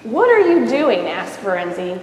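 lively read-aloud storybook voice; story narration. A narrator's reading. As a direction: read it to a child, voicing each character. Reads picture book aloud.

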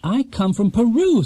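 lightly voiced young bear; Paddington voice.

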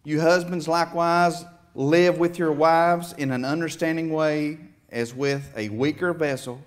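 usual speaking voice

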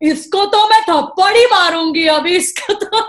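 playful fake fury; mock threat. Farah Khan joking she will slap the mimic, mock loud threat. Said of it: "She's like".

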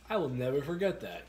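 ordinary talk

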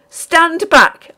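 high, loud shriek of "Stand back!"; frantic high yell. A narrator's reading. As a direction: scream at him to keep away. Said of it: "I shrieked".

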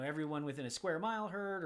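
normal talking voice

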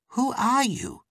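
ordinary delivery, nothing marked

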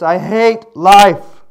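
loud, slow anguished shout; a preacher crying out his despair. Retold or imitated. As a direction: cry it out slowly, bent over. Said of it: "said in a very loud voice, maybe down on his, like this".

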